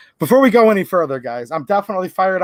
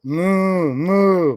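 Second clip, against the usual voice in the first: long, rounded moo; cow mooing. Acted. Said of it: "He can sound like a cow".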